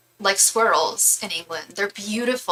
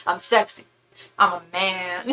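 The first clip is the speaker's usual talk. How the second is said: hint of a put-on star voice, brief pause between phrases; 2 semitones lower than usual. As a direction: lightly mimic a mock seductive boast. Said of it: "she did [name]".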